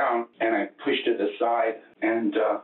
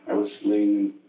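usual speaking voice

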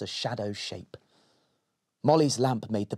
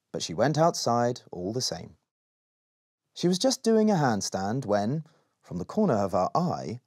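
usual speaking voice

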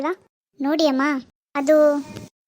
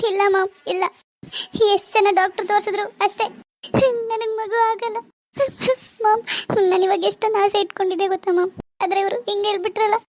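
put-on higher, tearful despairing young wife; tearful daughter; 5 semitones higher than usual. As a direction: complain to mom through tears. Daughter cries to her mother. (ಅಳುವ ಮಗಳು)

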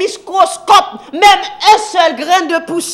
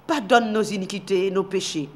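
humble prayer; 7 semitones lower, 10 dB quieter than usual. Acted. Moses prays humbly to God.

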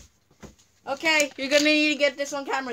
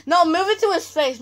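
raised bossy instruction; loud; 4 semitones higher, 9 dB louder than usual. Real, not acted. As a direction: call the order out. Directing the camera holder.

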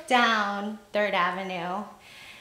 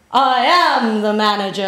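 loud, grand declaration; regal manager; 9 dB louder than usual. Retold or imitated. Manager answering the job seeker, loud and regal. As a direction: announce it like royalty. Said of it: "she looked very regal".

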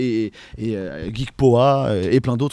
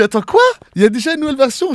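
high, loud incredulous outburst; mock disbelief; 11 semitones higher, 8 dB louder than usual. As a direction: play stunned at the news, voice shooting up. Reacts to yet another new edition.

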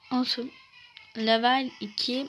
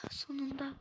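relieved murmur; 2 semitones higher, 9 dB quieter than usual. Real, not acted. Repeats softly after success.

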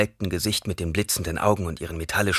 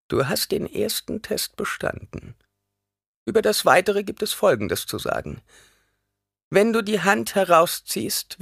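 put-on old crone voice; 11 semitones higher than usual. A narrator's reading. Old woman explaining the deadly test, high and solemn. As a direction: lay down the rules gravely.

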